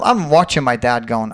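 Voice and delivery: usual speaking voice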